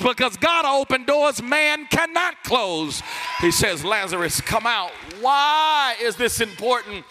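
loud, rising preacher's build; full-force preaching. Acted. Pastor preaching Jesus's answer to Martha at full force. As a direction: drive the point home hard.

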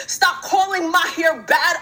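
usual speaking voice

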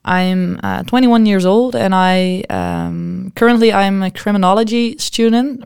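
ordinary talk

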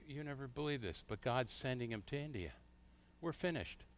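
imitating a crushed pastor, quietly; half-hearted lament, hushed and defeated; mock despair. Pastor retells despairing phone news.

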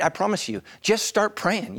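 ordinary delivery

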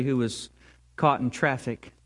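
ordinary talk, nothing marked

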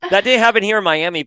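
usual speaking voice

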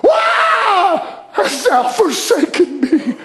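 crying out "why" in agony, drawn out; live the question as you cry it; preacher's impassioned cry from the cross; anguished cry of Jesus. Acted. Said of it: "He's not saying pretty words. He's experiencing why".

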